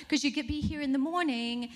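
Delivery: high, mock-posh foreign assistant voice; badly faked European accent, lightly done; hammy foreign receptionist; botched accent. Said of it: "botching this accent".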